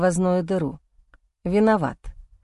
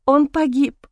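high, anguished cry of loss; distraught wife; 6 semitones higher, 3 dB louder than usual. Acted. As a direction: break out in grief as the wife. Wife cries out grief.

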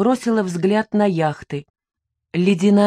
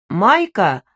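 louder, pitched-up call; 4 dB louder than usual. A narrator's reading. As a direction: call the name out to someone passing. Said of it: "окликнул меня сторож".

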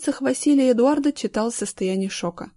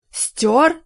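a sharp rising "Стёр?", higher and louder; surprised echo; 6 semitones higher, 5 dB louder than usual. A narrator's reading. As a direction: snap the word back in disbelief. Gorin reacts to the news.

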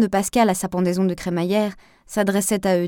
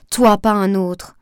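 loud, slow, every word driven home; shouting; 8 dB louder, slower than usual. A narrator's reading. Marianne insisting to Sam, slow loud shout. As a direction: hammer it out at full voice.

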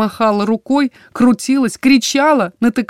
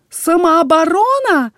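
high slow outraged wail; 9 semitones higher, slower than usual. A narrator's reading. Jeyna wails at the warden's ruling, high slow outraged wail. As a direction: wail the word in disbelief. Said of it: "взвыла Джейна".

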